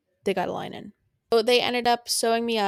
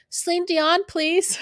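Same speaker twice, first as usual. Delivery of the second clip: cheeky request; 9 semitones higher, slower than usual. Retold or imitated. Cheeky music request in hospital.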